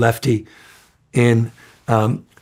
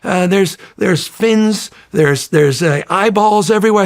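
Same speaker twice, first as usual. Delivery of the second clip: higher, panicked retelling of the night engineer; frantic report; 7 semitones higher than usual. Retold or imitated. Old engineer on the phone at four am, frantic. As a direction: imitate a frantic phone call, words tumbling out. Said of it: "He's like".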